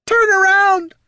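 high, loud squeaky plea; a frantic gadget begging; pleading robot voice. Acted. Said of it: "pleaded the mechanical gazer".

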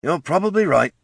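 high-pitched, resigned character voice; resigned sigh. A narrator's reading. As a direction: concede it with a sigh. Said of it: "sighed Rudge".

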